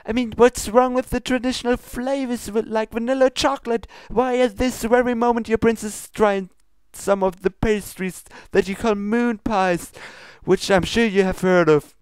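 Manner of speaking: high, formal princess scolding; lofty royal reproach, pitched high. Acted. Luna scolds Pinkie about her ingredients, high and prim. Character lecture continues.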